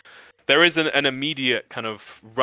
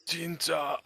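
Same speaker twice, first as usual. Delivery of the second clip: faint, frail call of a dying man; weak voice; 4 semitones higher, 8 dB quieter, slower than usual. Acted. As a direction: call out barely able to speak. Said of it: "Weak voice".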